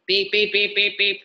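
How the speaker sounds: a short run of mock alarm beeps; imitated alarm beeps. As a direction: beep like an alarm clock that wakes you up.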